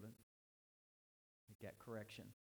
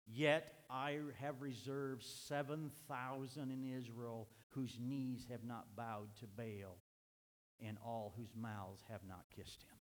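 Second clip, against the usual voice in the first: slow solemn reading; 3 semitones lower, slower than usual. Acted. God's words read slowly.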